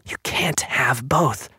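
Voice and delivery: slow, drawn-out whisper. A narrator's reading. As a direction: whisper each word slowly, eyes wide with dread. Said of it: "she whispered".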